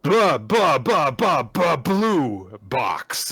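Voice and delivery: loud, high, drawn-out chant; mock segment fanfare. Co-host announces the returning segment, mock fanfare. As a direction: belt out a silly segment intro.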